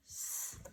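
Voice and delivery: S-a-b softly, as sss, uh, buh with long gaps; sounding out. Shown as a demonstration. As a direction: quietly sound out S-a-b, sound by sound. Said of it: "Let's build the first syllable".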